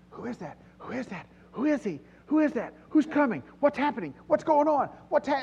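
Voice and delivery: rumor rippling through a crowd, pitch climbing; buzzing crowd voices. Acted. Preacher voicing the stirred city asking, excited and high. City's crowd murmurs spreading questions.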